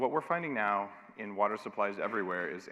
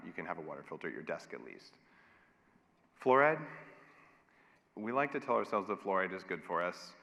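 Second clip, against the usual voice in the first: usual speaking voice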